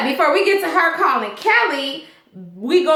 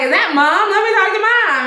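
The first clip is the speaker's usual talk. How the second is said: eager son; 8 semitones higher, 3 dB louder than usual. Retold or imitated. Voices son eager for mother.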